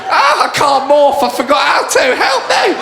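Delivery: high, shrill-free shouted plea for help; panicky Power Ranger. Acted. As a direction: squeal it like a helpless Ranger. Power Ranger pleading, voiced.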